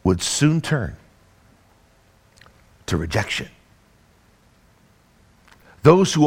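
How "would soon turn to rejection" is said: low dramatic fall. Real, not acted. Dramatic slow drop.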